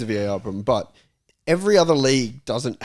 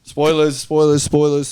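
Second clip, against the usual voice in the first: loud warning call, a bit higher; 5 semitones higher, 6 dB louder, slower than usual. Real, not acted. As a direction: shout it out to stop him. Cuts in before a plot reveal.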